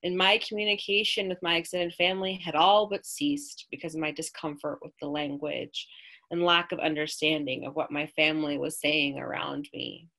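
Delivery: plain reading voice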